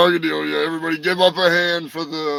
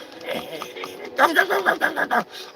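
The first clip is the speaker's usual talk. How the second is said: puppet cackle; 12 semitones higher, 3 dB quieter than usual. Acted. Puppet laughs after plug.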